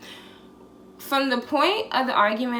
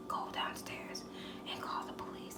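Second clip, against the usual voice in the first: hushed, voiceless whisper; 18 dB quieter than usual. Retold or imitated. Woman secretly tells friend to call police, whispered. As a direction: re-enact a secret whisper into someone's ear. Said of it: "I had whispered in his ear".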